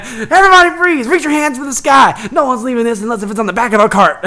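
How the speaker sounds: high, loud comic command; hammed-up gunpoint threat, easing toward laughter; mock hold-up. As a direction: shout it like an overacted stage robber. Ginger pulls fake gun on room.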